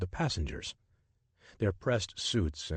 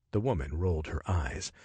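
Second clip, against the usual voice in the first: usual speaking voice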